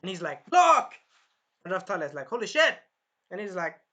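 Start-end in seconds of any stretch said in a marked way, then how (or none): 0.5-0.9 very loud, high yell; battle shout. Acted. Naofumi shielding Raphtalia, full yell. 2.3-2.8 loud, high startled cry; startled exclamation. Acted. Raphtalia startled by the fire attack, loud.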